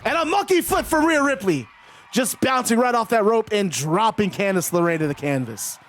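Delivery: lively ringside commentary, calling each move; play-by-play call. Acted. Calls wrestling match play-by-play.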